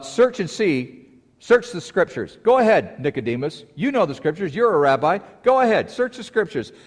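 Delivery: high, loud teasing challenge; playful taunt. Retold or imitated. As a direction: dare him to check the scriptures. Preacher taunting Nicodemus to search.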